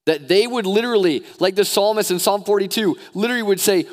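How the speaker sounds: high, loud preacher exhortation; rousing, urgent sermon delivery; impassioned preaching. Real, not acted. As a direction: preach it at full force, pitched well up. Preaching at full force.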